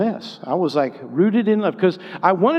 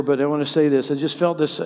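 normal talking voice

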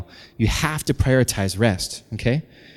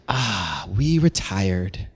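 low, slow, soft sigh of contentment; mock blissful ease, drawn out; contented retiree; 4 semitones lower, 3 dB quieter, much slower than usual. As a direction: settle back, low and lazy. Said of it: "you're just gonna be like".

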